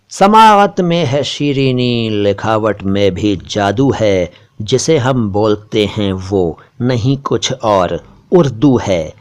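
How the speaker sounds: slow, measured couplet recital; poetry recitation. Acted. Closing the video with a sher, slow poetic recitation. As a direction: recite the verse slowly, letting the line endings settle. ये शेर सुनिए.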